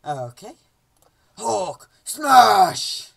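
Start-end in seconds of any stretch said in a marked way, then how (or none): 1.4-3.1 loud, forceful monster roar; Hulk. Acted. Player reading the Hulk level, big roaring shout. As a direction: bellow it like a raging brute.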